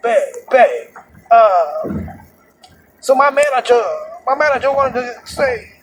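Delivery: high-pitched imitation of a woman passenger; put-on high female voice; imitated girlfriend. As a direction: mimic the girlfriend complaining on the phone. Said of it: "so she's like".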